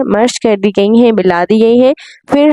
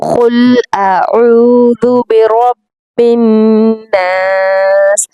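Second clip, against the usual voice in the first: slow chanted "कुल अऊदु बिरब्बिन", heavy कौफ; measured Quranic recitation with a heavy qaf; much slower than usual. Shown as a demonstration. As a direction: recite slowly, full qaf, stop on "नास". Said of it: "कुल कौफ को मोटा पढ़ना है".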